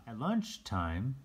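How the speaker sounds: usual speaking voice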